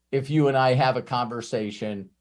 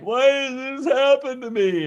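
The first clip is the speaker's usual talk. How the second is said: whiny complaint; 11 semitones higher, 4 dB louder than usual. Retold or imitated. Client's complaint voiced.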